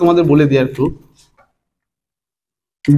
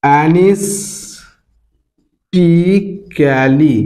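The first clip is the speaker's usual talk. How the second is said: very slow, long gaps between syllables; 2 semitones lower, much slower than usual. Shown as a demonstration. Teacher showing students a long word, syllable by syllable. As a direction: sound out the long word piece by piece. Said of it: "সিলেবালি উচ্চারণ করবে".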